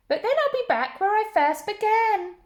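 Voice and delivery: put-on high, dismayed old lady voice; little old lady protesting the wise man's advice.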